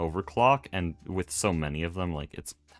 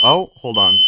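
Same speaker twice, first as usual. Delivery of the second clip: sudden loud startled call; loud alarmed oh, hold on; shouting over fire alarm; 2 semitones higher, 13 dB louder than usual. Real, not acted. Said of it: "My ears".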